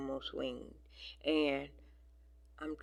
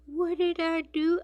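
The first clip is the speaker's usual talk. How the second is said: high, sweet mock-innocent squeak; a cat's wide-eyed "what did I do?"; innocent cat face; 13 semitones higher, 8 dB louder than usual. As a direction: voice the cat playing dumb, pitched way up. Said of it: "he looks at me like".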